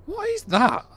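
reacting to a strange clicking noise; baffled. Real, not acted. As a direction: shoot up in real disbelief. Odd noise in the sim.

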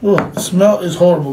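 ordinary talk, nothing marked

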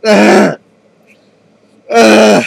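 loud gagging ughs; disgusted groans. Real, not acted. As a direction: groan through the burn. Reacting to awful drink.

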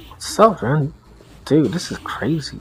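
normal talking voice

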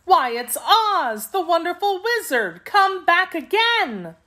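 high, delighted-to-see-him exclamation of surprise; surprised cry. A narrator's reading. Pretty girl greeting the returned wizard, a high surprised cry. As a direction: cry out in amazement, pitched well up. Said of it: "cried out in surprise".

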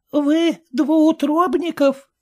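put-on high, slow, incredulous old dame; lightly voiced shocked old woman; startled old lady. A narrator's reading. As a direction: gasp it out in disbelief.

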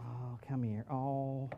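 a light take on a mom coddling a scraped knee; lightly voiced mother fussing over a boo-boo; doting mom. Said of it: "You know what mom would do?".

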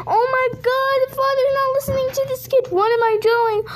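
high, rushed mock alarm; dramatic dismay. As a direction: wail in hammed-up distress. Reacts to father ignoring the child.

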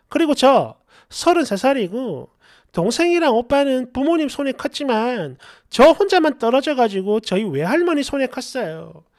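usual speaking voice

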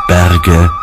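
ordinary delivery

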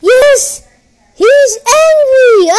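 high, drawn-out shout of delight; loud, high, cackling glee; gleeful triumph. Real, not acted. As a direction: shout in triumph, bursting into laughter. Delighted opponent is angry.

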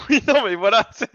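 bursting out laughing. Real, not acted. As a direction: laugh through the words. Player laughs at cough joke. (en riant)

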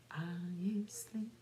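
ordinary delivery

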